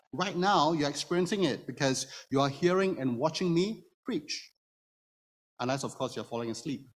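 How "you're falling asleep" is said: usual speaking voice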